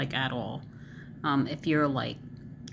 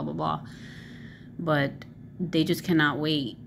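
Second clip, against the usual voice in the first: ordinary talk, nothing marked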